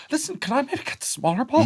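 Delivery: small, high, sheepish asking voice; timid high plea. Pretend. Man too proud to ask, pleading in a high timid voice.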